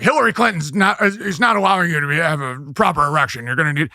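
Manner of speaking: a hint of a conspiracy radio rant, quick in the middle; Alex Jones style rant. Comic teasing Alex Jones in his own ranting style, lightly done. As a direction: riff it like his own broadcast. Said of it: "I'm like".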